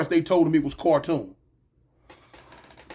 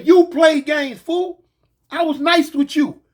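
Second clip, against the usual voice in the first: heated scolding; 7 semitones higher, 5 dB louder, slower than usual. Retold or imitated. Angry scolding of thief.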